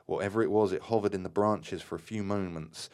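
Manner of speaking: ordinary delivery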